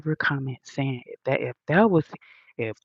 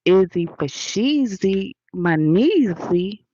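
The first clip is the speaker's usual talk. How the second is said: playful rhyme; 4 semitones higher, 4 dB louder, slower than usual. Real, not acted. Playful rhyming greeting.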